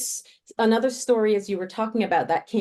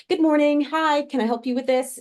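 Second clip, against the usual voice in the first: cheery, higher and louder hello to a stranger; bright friendly greeting; 7 semitones higher, 3 dB louder than usual. Retold or imitated. Woman recalls Caribbean warmth, greeting a stranger cheerily. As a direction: greet them like a friendly shopkeeper.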